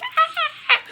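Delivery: very high, loud fangirl squeal with a laugh; excited squeal. Retold or imitated. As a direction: squeal with delight. Her reaction to Cap's intercom call.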